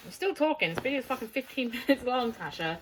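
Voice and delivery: half-laughing, talking over her friend; laughing protest. Real, not acted.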